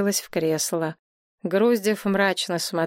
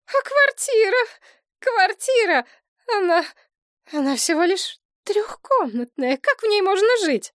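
hysterical; 13 semitones higher, 3 dB louder than usual. A narrator's reading. Elena hysterical about her flat.

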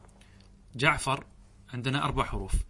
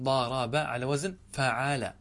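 drawn-out syllables; slower than usual. Shown as a demonstration. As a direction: slowly sound out "ضارب" and "فعال". Teacher matches word to its pattern. (نطق بطيء بمدّ المقاطع)

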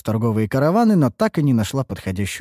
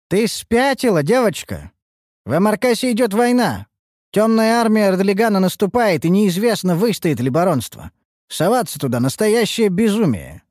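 stern caravan master; 9 semitones higher than usual. Acted. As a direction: scold the reckless girl. Караванщик отговаривает девочку. (строгий караванщик)

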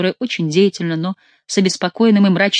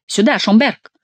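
higher call with rising end; 5 semitones higher, 4 dB louder, slower than usual. A narrator's reading. The king calls Schomberg over, calling out. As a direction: beckon someone over, voice lifted. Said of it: "позвал король".

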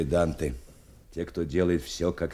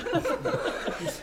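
short laugh. Acted. Teacher amused by student nicknames.

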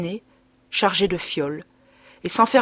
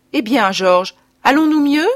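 calling from the door; 6 semitones higher, 7 dB louder than usual. A narrator's reading. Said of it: "demandait dès la porte".

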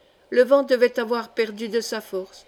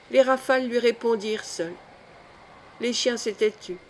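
ordinary delivery

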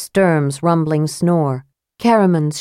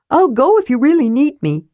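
Tass; 9 semitones higher, 4 dB louder than usual. Acted. Tass voiced.

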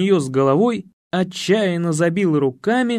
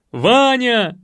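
high, loud shout of a name; орут хором; 7 semitones higher, 5 dB louder than usual. Acted. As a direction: yell it out like excited spectators. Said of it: "заорали Генри, Анна и Матрёна". (громко заорать)

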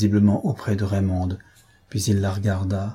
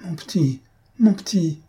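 stammering plea; 8 semitones higher than usual. A narrator's reading. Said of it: "Victoire balbutiait".

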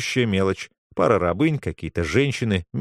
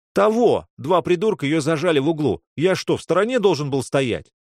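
angry outburst; 7 semitones higher than usual. A narrator's reading. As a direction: blurt it out defending her. Boy angrily defends himself.

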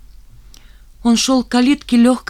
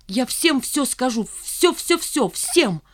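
взволнованные повторы, то выше, то ниже; повторяй одержимо, не останавливаясь; как в лихорадке; 4 semitones higher, slower than usual. A narrator's reading. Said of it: "Он был как в лихорадке". (лихорадочно, возбуждённо)

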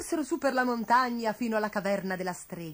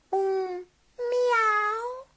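high, drawn-out miaow; cat's meow; 12 semitones higher, 3 dB louder than usual. A narrator's reading. Sutica announcing herself at the cave entrance, meowing. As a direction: mew it long and high like a cat.

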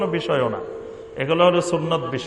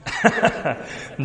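short laugh; 3 semitones lower, 5 dB quieter than usual. Real, not acted. Audience question amuses him.

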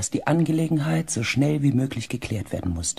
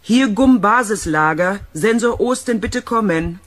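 raised voice, higher pitch; loud radio call; 6 semitones higher, 8 dB louder than usual. Acted. Calling into the radio. (laut in das Funkgerät rufen)

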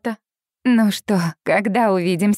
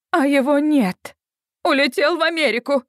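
upset mother; 4 semitones higher than usual. Acted. Mother's distressed report.

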